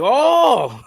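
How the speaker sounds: high rising surprised oh; pleased surprise. Retold or imitated. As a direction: sound happily surprised. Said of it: "they be like".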